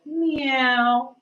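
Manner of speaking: a high, loud, slow meow; cat meow. Retold or imitated. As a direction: stretch out a cat's meow.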